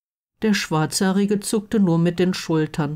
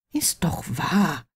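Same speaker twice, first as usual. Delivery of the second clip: grumbling; 3 dB quieter than usual. A narrator's reading. Blond friend defending his jibe, grumbling. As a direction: grumble it half to yourself. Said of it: "grummelte der Blonde".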